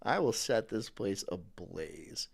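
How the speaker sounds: understated sinister vow; menacing threat. Acted. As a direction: say it like a quiet villain. Fantasizing burning the office.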